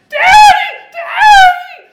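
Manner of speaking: put-on high piercing child's scream. Father imitating his little daughter screaming for him. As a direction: shriek like a frightened little girl.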